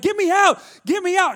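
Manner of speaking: high, loud mock-panic cry; frantic high-pitched plea, replayed for laughs; panicked yell. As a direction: yell for rescue, acting out terror. Reenacts panicked escape to the boat.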